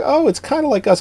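high, girlish mock voice; imitating wife. Wife comparing the film to the couple, mock high voice. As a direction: pitch it far up as a teasing impression. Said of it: "She's like".

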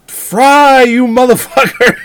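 high, loud mock yell of the wife; hammed-up shriek. Wife yelling at the executed man, hammed-up loud shriek.

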